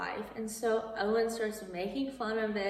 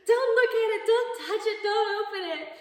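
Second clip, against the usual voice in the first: much higher, much louder panicked commands; frantic yelling; 12 semitones higher, 14 dB louder, faster than usual. Retold or imitated. Flustered Josh stopping Natalie opening his box, frantic yelling. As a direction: yell to stop her before she opens the box.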